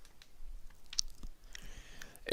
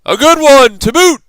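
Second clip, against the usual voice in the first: much higher, loud crew of pirates; pirate crew chorus; 12 dB louder than usual. A narrator's reading. Pirate crew agreeing with their captain, rowdy high chorus. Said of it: "the others agreed".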